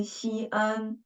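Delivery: "Xi'an" said as "xi", then "an"; two syllables. Shown as a demonstration. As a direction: separate the halves of "Xi'an". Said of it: "two syllables".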